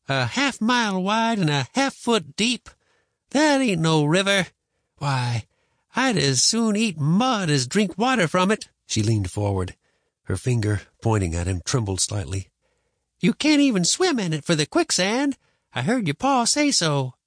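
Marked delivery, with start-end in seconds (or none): 0.1-8.7 high, drawn-out scorn; disgust. A narrator's reading. Grandma belittling Trouble River to boy, disgusted. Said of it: "Her disgust was plain". 13.2-17.1 high-pitched grandma laying down the facts; scolding grandma. Acted. Grandma warning boy about quicksand, insistent.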